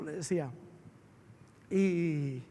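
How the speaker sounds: ordinary talk, nothing marked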